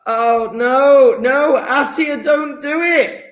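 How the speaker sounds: high, drawn-out cry of dismay; high-pitched slow wail of protest; pleading dismay. Real, not acted. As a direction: plead with them to stop, stretching it out. Mock horror at student's chat post.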